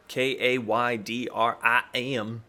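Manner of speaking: letter by letter, very slow; spelled out slowly. Shown as a demonstration.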